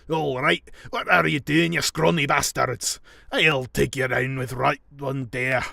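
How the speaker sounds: mock-belligerent Scottish yell; Scottish accent. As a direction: threaten them in a shouted Scottish accent. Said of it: "my Scottish accent is actually pretty good".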